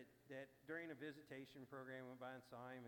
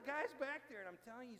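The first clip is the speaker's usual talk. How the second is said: ordinary talk, nothing marked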